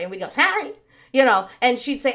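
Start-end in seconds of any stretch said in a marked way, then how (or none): 0.3-0.7 higher, grudging mock-child sorry; imitating a kid's forced sorry; insincere apology. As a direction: toss off an apology you don't mean. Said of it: "Say it like you mean it".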